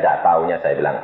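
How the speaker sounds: usual speaking voice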